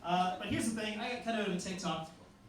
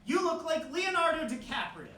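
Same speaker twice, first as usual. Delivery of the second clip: high-pitched heckler's call; pitched-up shout-out from the audience; 9 semitones higher than usual. Retold or imitated. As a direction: imitate a voice calling from the dark. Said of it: "I just hear someone go".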